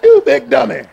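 loud, laughing "You big dummy"; laughing mock Fred Sanford; Sanford and Son quote. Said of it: "as they say from Sanford and Son".

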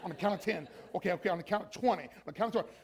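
soft, low, private self-talk; inner voice. Retold or imitated. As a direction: mutter to yourself under your breath. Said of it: "when you go in your mind, you go".

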